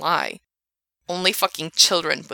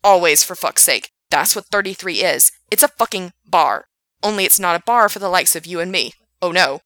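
furious rant; 7 dB louder than usual. Acted. Frank ranting at Gerard.

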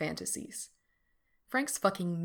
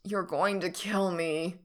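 groan; slower than usual. A narrator's reading. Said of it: "Frank groans".

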